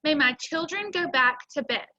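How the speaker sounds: ordinary delivery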